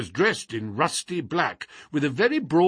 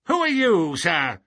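high, sharp challenge; indignant old man's question; querulous old man, demanding; 6 semitones higher, 4 dB louder than usual. A narrator's reading.

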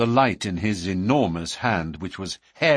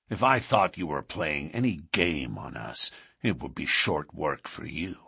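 low-pitched threatening lodge boss; a low warning to a suspect newcomer; menacing boss; 6 semitones lower, 4 dB quieter than usual. Acted.

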